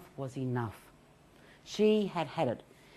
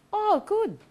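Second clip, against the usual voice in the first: dismissive friend; 4 dB louder than usual. Retold or imitated. Friends brushing off your anxiety.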